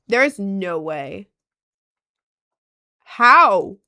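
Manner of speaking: loud, shocked "how". Real, not acted. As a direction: blurt it out in total disbelief. Shocked at pregnant grandma.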